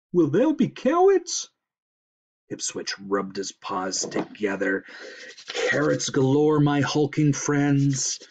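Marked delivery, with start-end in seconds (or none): 0.1-1.5 high, loud, eager moose; hopeful moose. A narrator's reading. 5.5-8.2 loud, raised, drawn-out promise; grand promise. A narrator's reading. Schemer promises the moose carrots.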